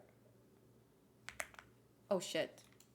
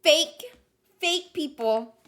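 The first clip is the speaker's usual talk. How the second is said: loud scoff; 6 semitones higher, 8 dB louder, much slower than usual. Real, not acted. Calling out fake people.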